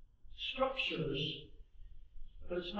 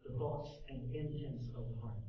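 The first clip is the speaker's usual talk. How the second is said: usual speaking voice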